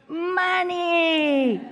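loud, high, drawn-out word; big triumphant reveal. Acted. Storyteller gives children the answer, called out loud. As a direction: call it out long and loud.

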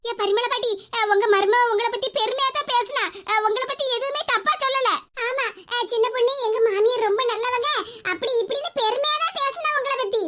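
normal talking voice

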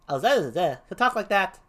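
lightly voiced second clown; a hint of a comic character. Shown as a demonstration. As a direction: try out a different gravedigger. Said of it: "the second clown shall be".

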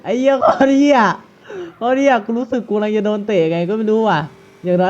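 excited loud swearing. Real, not acted. Stealing car, excited swearing.

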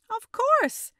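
high, loud bright answer; eager high-voiced reply; Gamilla's reply. A narrator's reading. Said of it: "Gamilla replied".

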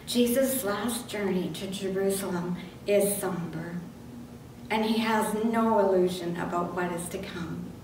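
ordinary delivery

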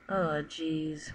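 usual speaking voice

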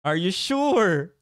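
slow, drawn-out question with a laugh; laughing, slow skeptical question; teasing doubt. Real, not acted. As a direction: needle him playfully. Teasing reply to a comment.

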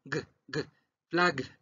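isolated hard "g" sounds, then "flag"; guttural G. Shown as a demonstration. Teacher drills the final sound, guttural G in "g, g, flag". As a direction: pop the voiced G, then put it on "flag". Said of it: "Esta G es gutural".

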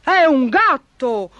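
high, drawn-out triumphant exclamation; baron's cunning shout of discovery; crafty cry. A narrator's reading. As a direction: pounce on it with sly glee. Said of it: "gridò furbissimo il barone".

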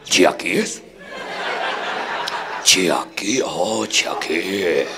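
very low, soft mimicry in mock Chinese accent; mock Chinese diner. Chinese diner copying a new order, deep slow mock-Chinese voice. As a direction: practise the new word deep and drawn out.

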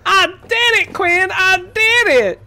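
high, loud, drawn-out cry; hammed-up triumphant yelling; triumphant shout. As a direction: shout it high as a mock victory announcement. Acting out a triumphant character.

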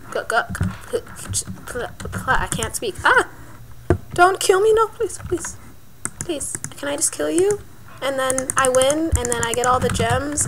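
normal talking voice

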